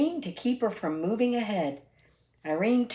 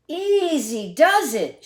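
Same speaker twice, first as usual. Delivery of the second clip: cautioning call; 8 semitones higher, 6 dB louder, slower than usual. Acted. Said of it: "she cautioned the wind".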